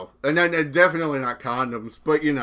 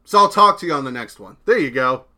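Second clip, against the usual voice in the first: ordinary delivery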